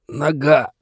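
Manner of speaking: high, squeezed cry of pain; strangled with pain. A narrator's reading. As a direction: choke the word out as if wounded. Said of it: "сдавленно произнёс Брэндон, морщась от боли".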